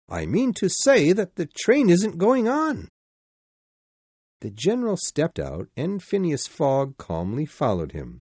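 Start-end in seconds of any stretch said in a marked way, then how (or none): 0.1-2.9 excited servant voice. Acted. Passepartout explains the stop.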